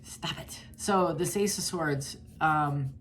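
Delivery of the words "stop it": "Stop it" whispered with no voice, much softer. Retold or imitated. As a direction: hiss it out as a final warning. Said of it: "I'm like".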